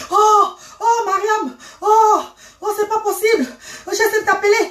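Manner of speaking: high-pitched laughing greeting on the phone; a relieved uncle laughing as he picks up. Retold or imitated. (oncle qui rit fort au téléphone, voix haute)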